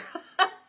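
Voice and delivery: quick high giggle; a little laugh at herself; short bright laugh. Real, not acted. Host laughing to viewers about being tired. Laughs at her own joke.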